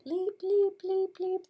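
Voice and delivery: quick, very high squeaky peeps; cricket chirping. Shown as a demonstration. Teacher shows kids what chirping sounds like, high cricket peeps. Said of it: "what does it sound like when they're chirping".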